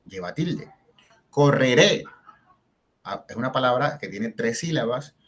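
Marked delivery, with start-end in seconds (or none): 1.3-2.0 Correré with a loud, rising final -ré; co-rre-RÉ, strong on the last syllable; stress on last syllable. Shown as a demonstration. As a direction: lift and punch the end of Correré. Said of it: "la fuerza de entonación está en la última".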